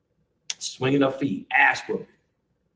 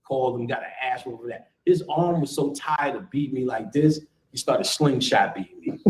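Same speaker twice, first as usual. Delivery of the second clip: ordinary delivery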